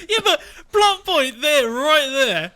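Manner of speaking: loud, high, laughing through it; can't stop laughing while talking. Real, not acted. Laughing through a plot point.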